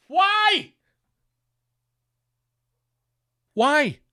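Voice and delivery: high, loud, incredulous why; exasperated shout. Acted. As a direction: yell it in disbelief at the absurdity. Rant about Scottish men playing basketball.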